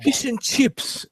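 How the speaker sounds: high, slow and drawn out with delight; excited. Real, not acted. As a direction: savour the words. Excited naming the dish.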